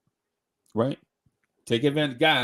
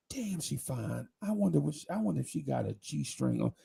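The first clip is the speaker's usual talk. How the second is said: inner thoughts, hushed; 11 dB quieter than usual. Retold or imitated. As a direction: mutter it like a guy's dirty thought.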